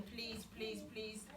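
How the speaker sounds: quiet, repeated begging please; pleading softly. A narrator's reading. Joseph begs his brothers in the pit, soft pleading. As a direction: beg them gently, almost under your breath. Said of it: "He's pleading with them".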